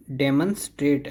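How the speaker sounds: डेमोन्स्ट्रेट with "deh" and a weak "mun"; correct pronunciation. Shown as a demonstration. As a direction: say "DEM-un-strate" for डेमोन्स्ट्रेट. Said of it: "नहीं".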